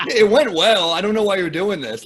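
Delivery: loud, high, mock indignation; exasperated protest. Comic to pitying fan after a good set, exasperated. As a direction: protest through a laugh.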